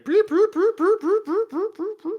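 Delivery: repeated high trilled brooping, loud then fading; high droid running noises. Acted. Droid runs off ahead of party, high trilled broops. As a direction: imitate a little droid whirring away. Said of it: "runs off going".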